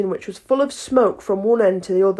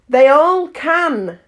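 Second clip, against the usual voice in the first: slow Duchess; 5 semitones higher, 5 dB louder, much slower than usual. A narrator's reading. Duchess speaking.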